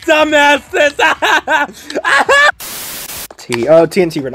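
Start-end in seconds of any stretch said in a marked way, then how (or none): 0.1-3.4 high laughing shouts at losers; cackling insult; laughing taunt. Real, not acted. Mocks losers while laughing.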